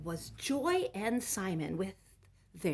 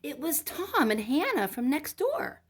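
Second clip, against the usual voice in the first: bright reveal; 9 semitones higher, 10 dB louder than usual. A narrator's reading. Doorbell reveal in story.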